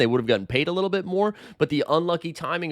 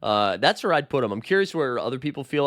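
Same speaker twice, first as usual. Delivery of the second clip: usual speaking voice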